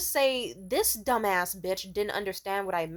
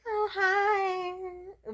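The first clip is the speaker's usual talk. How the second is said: high, drawn-out two-faced greeting; fake nice tone; 12 semitones higher than usual. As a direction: greet them with put-on niceness, way up high. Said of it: "They just use the nice tone with it".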